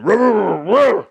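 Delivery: high, loud mock grunting; hammed-up caveman noises; caveman grunts. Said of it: "like, a caveman".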